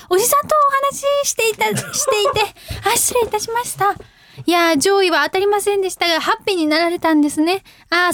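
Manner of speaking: customer-service politeness, pitch lifted; call-centre operator. Acted. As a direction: play an apologetic agent wrapping up a call. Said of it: "コールセンター。".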